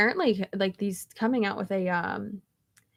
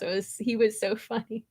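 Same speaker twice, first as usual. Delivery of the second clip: ordinary talk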